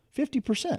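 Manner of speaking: FIFTY PERCENT pitched very high for emphasis; "Fifty percent" repeated high, in protest; emphatic repeat. Real, not acted. Repeats shocking prison statistic for emphasis.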